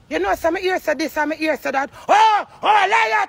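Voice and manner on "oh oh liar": higher, louder mocking cry of "liar"; mock gossip shout. Mimicking the people gossiping about her, hammed-up shout. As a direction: imitate the gossips yelling "liar".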